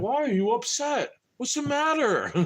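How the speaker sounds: high mock-innocent voice; mocking innocence. People who annoy him, mocked in a high innocent voice. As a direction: mimic them playing dumb, high. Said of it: "They're like".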